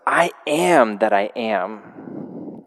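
very slow, weighty declaration; slow solemn I am. Retold or imitated. Pastor voicing God's name to the congregation, slow and weighty. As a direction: let each word land heavily.

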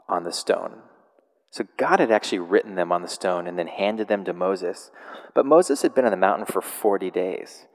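ordinary delivery

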